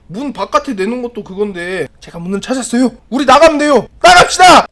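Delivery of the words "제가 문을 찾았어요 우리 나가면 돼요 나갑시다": rally them, ever louder; too-loud announcing. Acted. Woman telling them to leave, building to a shout. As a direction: build from normal voice to high shout. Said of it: "너무 크게 얘기해".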